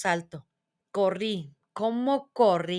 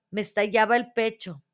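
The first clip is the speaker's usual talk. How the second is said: plain reading voice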